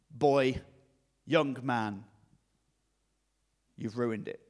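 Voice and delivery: low, slow, forbidding authority figure; deep-pitched, weighty telling-off with long pauses; stern headmaster. Pretend. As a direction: pronounce judgement like a strict headmaster. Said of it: "or a sergeant major or a demon headmaster".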